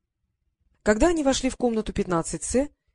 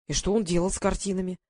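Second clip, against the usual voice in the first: ordinary delivery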